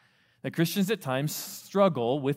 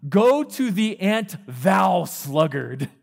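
slow, loud, booming verse; dramatic scripture reading; 4 semitones higher, 6 dB louder, much slower than usual. Acted. Preacher booming the verse to his youth group. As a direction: proclaim it slowly like scripture.

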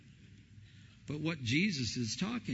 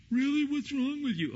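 much higher, mock disbelief; mock incredulous; 11 semitones higher than usual. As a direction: toss it back in mock surprise. Listeners mock him.

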